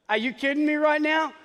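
like you can't believe what you just heard; incredulous. Real, not acted. Preacher to congregation, incredulous and pitched high.

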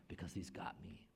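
soft unvoiced whisper; hushed, intimate certainty. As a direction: whisper the assurance. Whispered conviction.

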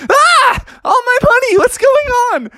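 very high, loud alarm; acted cartoon freak-out; panicked Froggy. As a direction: shriek over losing everything. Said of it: "And Froggy was like".

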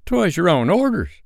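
high-pitched, faltering excuse. A narrator's reading. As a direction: protest timidly, pitched high. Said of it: "the boatswain faltered".

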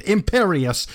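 understated storybook shout; mild mock shout. A narrator's reading. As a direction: narrate a shouted spell without really shouting. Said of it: "Voldemort shouted".

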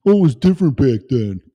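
loud, fast whiny parent parody; mock the old excuse loudly.

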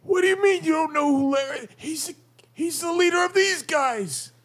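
strained goblin voice. Acted. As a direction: squeeze it out as a baffled goblin. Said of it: "that accent and the drained voice".

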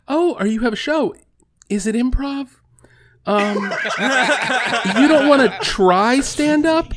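high, sweet imitation of mom. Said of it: "will be like".

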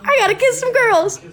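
imitating Gary. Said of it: "Gary said".

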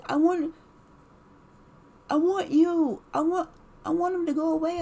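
put-on high pleading little-boy voice; whiny childlike husband. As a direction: whimper it like a sulking child.

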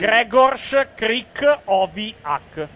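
"Gregors Krikoviak" read slowly, syllable by syllable; pronounced as written. Shown as a demonstration. Host demonstrating UEFA's written guide, slow syllabled pronunciation. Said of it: "la pronuncia come l'hanno scritto".

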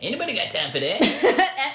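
high, slow sassy meme voice; meme catchphrase. Retold or imitated. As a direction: deliver it like the viral meme.

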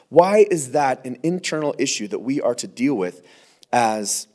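usual speaking voice

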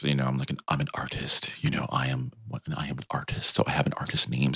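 deadpan it with understated pretension; mock serious. Himself naming his DJ act, mock serious artist. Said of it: "I was trying to be really serious".

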